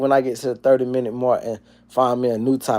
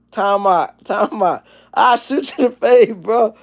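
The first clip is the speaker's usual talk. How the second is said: mock fight plea; 9 semitones higher than usual. Acting out a fight, hurt back.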